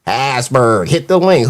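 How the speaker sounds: lightly mock his request; a hint of someone else's low voice; mimicking the caller. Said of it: "that shit get me every time".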